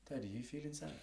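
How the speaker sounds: put-on high-pitched child's question; imitated young daughter. Six-year-old daughter checking on dad, imitated in a high voice. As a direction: lightly voice a little girl asking, pitched well up. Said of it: "Ella came up to me and was just like".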